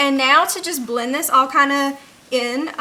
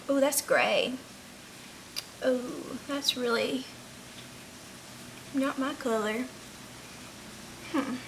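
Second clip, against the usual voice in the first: quiet disappointment; 12 dB quieter than usual. Real, not acted. Disappointed by the eyeshadow colour.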